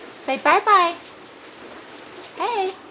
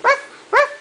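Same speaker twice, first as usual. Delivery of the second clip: high, louder mock woof; playful dog bark; 8 semitones higher, 4 dB louder than usual. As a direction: bark like a puppy to amuse the baby. Parent barking like dog for baby.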